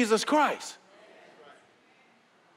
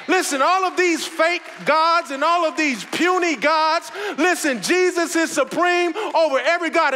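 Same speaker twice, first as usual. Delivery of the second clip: preaching; 6 semitones higher than usual. Acted. Preaching climax.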